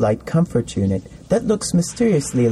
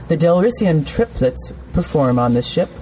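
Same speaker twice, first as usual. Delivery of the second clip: ordinary delivery, nothing marked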